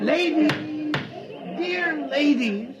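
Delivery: high, slow coaxing call. Acted. Captain coaxes the chattering ladies to settle, high and slow. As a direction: coax the crowd into silence.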